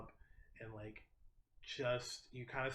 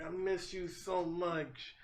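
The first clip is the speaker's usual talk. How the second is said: tender; 6 semitones higher, slower than usual. Acted. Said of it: "she's just like".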